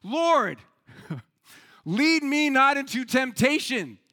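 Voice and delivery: loud, high shout to God; acted frantic shouted plea; shouted prayer. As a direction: bellow the prayer in comic desperation. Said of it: "and he shouts".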